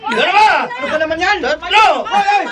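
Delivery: loud shouted count; referee count. Acted. Referee shouts the knockdown count over the fallen fighter. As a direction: call each number to the ring.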